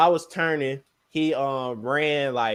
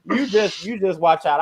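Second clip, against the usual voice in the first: higher, louder mock warning; warning doctor off; 5 semitones higher, 8 dB louder than usual. Patient refusing the regular doctor, loud high warning. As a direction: wave the doctor off loudly.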